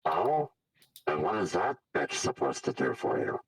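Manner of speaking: loud scornful creature voice; sneering villain. Acted. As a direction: sneer down at her. Villain Raz mocking.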